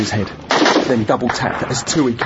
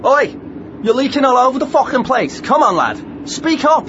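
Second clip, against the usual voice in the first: rough shout; 11 semitones higher than usual. Acted. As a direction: bark him awake with a grin. Rousing wounded man, shouting.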